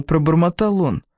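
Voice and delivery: ordinary delivery, nothing marked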